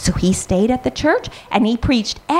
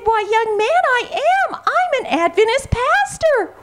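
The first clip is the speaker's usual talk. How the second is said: much higher, beaming pastor voice; jolly pastor; 12 semitones higher than usual. Acted. Pastor telling young Elias he is an Adventist pastor, delighted. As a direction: announce it with a cheerful lift.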